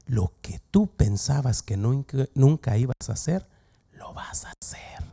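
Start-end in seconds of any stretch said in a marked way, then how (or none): 3.9-5.0 hushed, voiceless warning; whisper. Acted. Pastor warns the church what the enemy will make you do, in a whisper. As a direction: whisper it like a secret threat.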